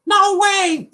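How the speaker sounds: very high, loud squawk; crow's refusal. Acted. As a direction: squawk it out as a defiant crow. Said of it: "look down and say".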